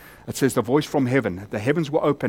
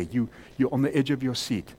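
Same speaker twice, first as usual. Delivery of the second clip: ordinary delivery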